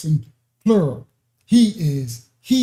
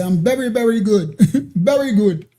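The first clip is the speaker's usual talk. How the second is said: put-on jokey East Indian accent, pitched higher, breaking into laughter; hammed-up East Indian accent; mock East Indian accent; 5 semitones higher than usual. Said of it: "like, East Indian".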